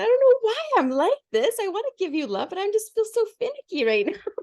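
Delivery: high-pitched, pleading acted self-quote; plaintive retold plea. Finicky wife explaining herself to her husband, high mock plea.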